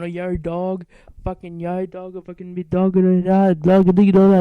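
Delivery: hammed-up imitation of a school kid posing as a rapper, building to loud. Kid at school accosting the narrator, mock rapper voice building loud. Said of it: "you had your [name] wannabes".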